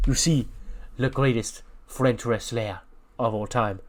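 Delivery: slow, grandiose French lecturer; hammed-up French teacher, pausing between phrases; French accent. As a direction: proclaim it in a mock French accent.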